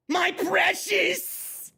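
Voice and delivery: high, very slow, shouted Gollum whine; mock Gollum squeal; Gollum impression. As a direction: drawl "precious" like a greedy cave creature. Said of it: "she's got this high-pitched tone".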